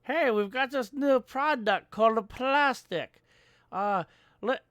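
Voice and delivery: high-pitched mock sales pitch; imitated eager inventor. Inventor selling a new product called plastic, excited mock pitch.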